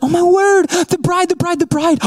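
high, giddy repeating; excited squeal. Retold or imitated. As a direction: squeal at the sight of the bride. Guest spotting the bride.

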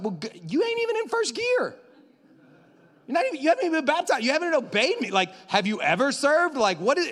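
high, loud mock-incredulous scolding; hammed-up disbelief; God's exasperated reply. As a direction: play God calling out someone unbaptized. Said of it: "and he's like".

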